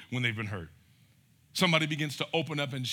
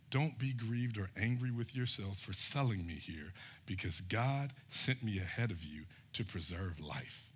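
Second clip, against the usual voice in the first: softer and slower comfort; tender reassurance; 6 semitones lower, 8 dB quieter than usual. Retold or imitated. Joseph reassures his guilty brothers, soft and slow. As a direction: ease their guilt gently.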